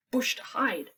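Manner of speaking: usual speaking voice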